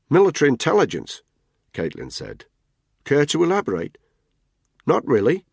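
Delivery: flat Australian menace, promise it; Australian threat. Acted. Caitlin warns Tony off calling her love, Australian accent. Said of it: "Her accent was heavily Australian".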